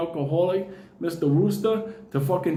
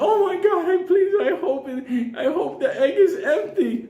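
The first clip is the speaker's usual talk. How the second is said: high, breathless hoping client; gasping client pleads, high and hopeful; excited gasp; 11 semitones higher than usual. Retold or imitated. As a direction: squeal with desperate hope. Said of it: "the people are like".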